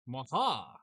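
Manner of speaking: loud gloating villain laugh; evil laugh. Pretend. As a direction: cackle like a cartoon villain. Gloating laugh after scheming.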